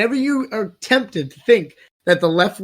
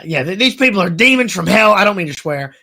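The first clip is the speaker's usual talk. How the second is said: usual speaking voice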